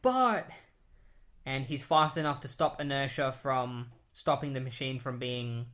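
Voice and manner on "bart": sudden high shout of the name; calling out Bart. Retold or imitated. Iris sees Bart fighting, shouts high. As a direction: cry out his name in alarm. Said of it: "She's like".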